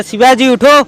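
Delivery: put-on an angry principal shouting at the boy in assembly; retold principal's shout. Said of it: "बोला". (गुस्से में principal की चिल्लाहट की नकल)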